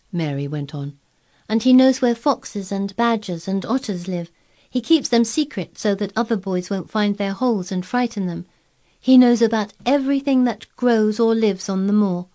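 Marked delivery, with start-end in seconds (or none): 1.5-12.3 lightly voiced young girl; a girl telling eagerly. A narrator's reading.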